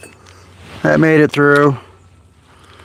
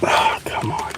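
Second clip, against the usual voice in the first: softer exasperated whisper; frustrated whisper; 9 dB quieter than usual. Real, not acted. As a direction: breathe it out, fed up. Frustrated with the drill.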